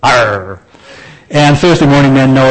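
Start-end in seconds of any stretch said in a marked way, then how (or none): 0.0-0.6 low pirate "Arr". Pretend. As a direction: growl it like a pirate. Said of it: "it's okay to talk like a pirate".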